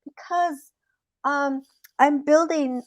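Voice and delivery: small, high hesitant voice; lightly voiced sheepish rabbit; stalling rabbit. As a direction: fumble softly for an excuse. Rabbit invents an excuse.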